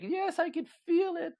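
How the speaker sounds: high, loud, drawn-out mock outburst; heated retort. Woman answering the man's denial, high heated retort. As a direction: exaggerate an indignant comeback, way up in pitch.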